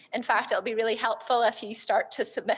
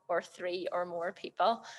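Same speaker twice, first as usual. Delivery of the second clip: ordinary talk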